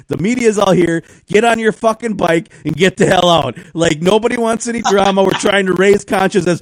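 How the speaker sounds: scolding the cyclist to leave, loud; retold scolding. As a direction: scold him and send him away. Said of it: "I'm like".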